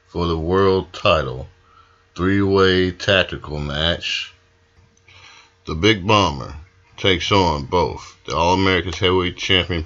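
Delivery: loud hype announcer; wrestling promo. Acted. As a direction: sell the big match loud. Host hypes the title match.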